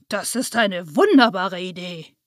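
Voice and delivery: light up at the idea; delighted. A narrator's reading. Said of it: "freute sich der Weihnachtsmann".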